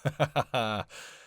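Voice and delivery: short amused cackle; bright burst of chuckling; teasing laugh. Acted. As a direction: laugh at their expense. Teasing the tired driver.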